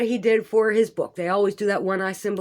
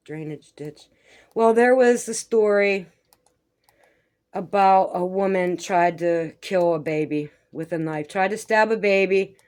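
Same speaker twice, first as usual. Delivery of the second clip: ordinary delivery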